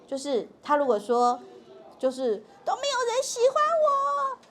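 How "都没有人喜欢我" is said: high-pitched mock wail of an angry child; 盛怒的孩子. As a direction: cry it out like a child in a fit, far above your own pitch. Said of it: "所以盛怒的时候". (模仿孩子盛怒时拔高嗓门哭喊)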